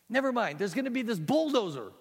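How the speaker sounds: high, loud stammering; flustered boy. Acted. Boy stammers to the guard ant, high and flustered. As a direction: trip over every word in panic.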